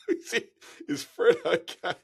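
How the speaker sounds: half-laughing mock panic; laughing worry. Guide retelling his panic over Fred, laughing.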